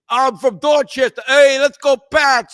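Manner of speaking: loud, high Boston fan caricature slipping into Italian; botched Boston accent. Acted. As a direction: bellow like a Boston Pats fan, badly accented. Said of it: "I don't know why I'm doing an Italian".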